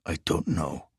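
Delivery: low, quiet, bass-heavy foreign thief; deep foreign voice. A narrator's reading. Riso answers his partner's questions in a low bass voice.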